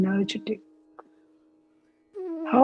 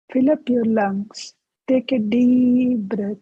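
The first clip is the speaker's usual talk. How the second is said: guided meditation. Acted. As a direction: guide them gently into each breath. Leads a breathing meditation.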